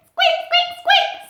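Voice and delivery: very high squeaking mouse; squeaky mouse. Acted. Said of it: "said the mouse".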